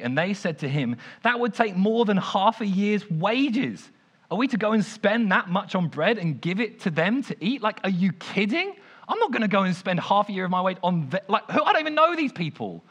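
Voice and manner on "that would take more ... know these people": higher, louder exasperated outburst; snapping disciples. Retold or imitated. Disciples snapping at Jesus over the cost, exasperated. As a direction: blow up at him in disbelief. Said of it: "So they snap, they absolutely lose it".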